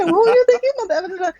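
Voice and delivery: high, rushed imitation of their bickering; mock heated quarrel, rushed. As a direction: fire it off fast like a playful spat. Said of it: "this is how we talk to each other".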